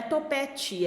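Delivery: topete ending in a "chee" sound; spoken form, rejected. Shown as a demonstration. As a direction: show topete with "tchi" in place of te. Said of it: "Não é topete". (topete com final "tchi")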